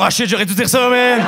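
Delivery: frustrated exclaim. Retold or imitated. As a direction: kick yourself out loud. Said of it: "j'étais comme". (exclamation frustrée, plus forte et plus aiguë)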